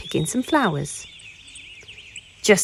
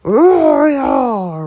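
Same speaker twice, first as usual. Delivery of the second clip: loud drawn-out bear roar; 3 semitones higher, 8 dB louder than usual. A narrator's reading. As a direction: roar big to scare the goose. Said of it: "repeated the bear".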